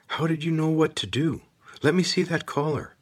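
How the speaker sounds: high, eager questions; eager boy voice. Acted. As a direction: press her for answers, excited. Corvin eagerly asks Kate.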